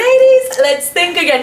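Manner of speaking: high, loud mock warning; playful scolding. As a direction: wag a finger at the ladies playfully. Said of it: "I'm like".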